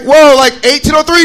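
loud, high alarmed question; shocked disbelief. Retold or imitated. Traveler hearing the year, loud shock. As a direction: shout the year back in shock.

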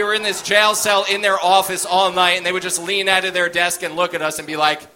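ordinary delivery

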